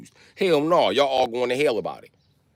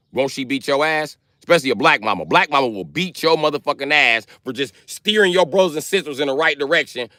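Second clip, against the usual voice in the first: normal talking voice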